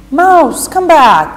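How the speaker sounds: Alice calling the mouse back. Acted. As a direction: shout after the mouse swimming away. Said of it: "Alice called out".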